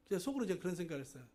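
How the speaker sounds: normal talking voice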